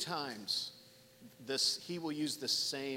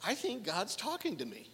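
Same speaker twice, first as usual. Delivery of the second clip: higher-pitched voice of a thought just dawning; dawning realization; 6 semitones higher than usual. Acted. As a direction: act out a tentative, wondering realization. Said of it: "you're starting to, to realize".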